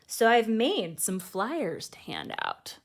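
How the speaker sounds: put-on lively read-aloud voice for children; storybook reading. A narrator's reading. Host reading a picture book to kids, lively storytelling. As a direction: read it like bedtime story time.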